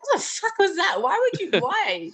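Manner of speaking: high-pitched mortified inner voice; inner cringe. Retold or imitated. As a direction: yell at yourself in disbelief. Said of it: "I'm sort of in my own head".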